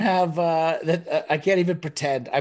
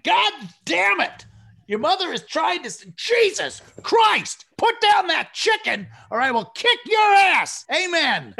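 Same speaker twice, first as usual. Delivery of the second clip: higher, louder, slow mock yell; dad yelling; 6 dB louder, slower than usual. Dad yelling at brothers eating during grace, hammed-up shout. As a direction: bellow at the kids like an exasperated dad. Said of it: "my dad literally going".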